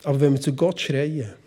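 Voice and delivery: ordinary delivery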